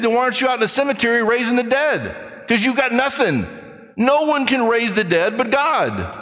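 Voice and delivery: high, fast challenging preacher; fiery preaching. Acted. Preacher challenging a doubter, high and fast. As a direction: press the skeptic hard, rising up.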